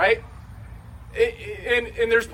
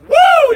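a shrill whoop of joy; loud high celebratory woo; 13 dB louder than usual. Retold or imitated. Woman celebrating her healing at home, loud woo. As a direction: whoop like you just won. Celebrating alone with jumping jacks.

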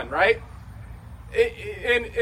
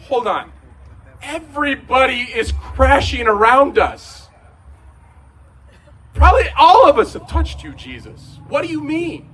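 exasperated disciples; 5 semitones higher, 7 dB louder than usual. Retold or imitated. Disciples protesting the crowd.

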